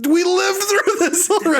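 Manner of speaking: very high, incredulous squeal; exasperated. Real, not acted. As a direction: protest in a comically high voice. Exasperated at the stale material.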